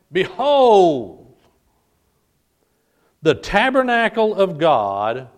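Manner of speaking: loud, emphatic scriptural proclamation; attention-grabbing cry. Acted. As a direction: ring it out to seize the congregation's attention. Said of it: "used to grab your attention".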